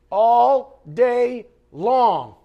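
all day long, dragged out for the congregation; slow emphatic. Real, not acted. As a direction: stretch every word and lean in. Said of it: "slow emphatic repeat".

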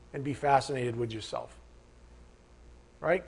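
normal talking voice

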